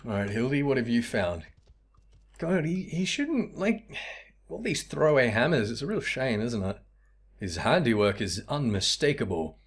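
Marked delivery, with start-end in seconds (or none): 2.4-6.8 a put-on higher voice, mildly fussy; lightly voiced high character. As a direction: lament the hammers in a higher pitch.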